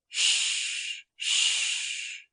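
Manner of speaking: quiet whispered shh shh; soft hushing. A narrator's reading. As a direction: hush a child back to sleep. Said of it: "His mother hushed".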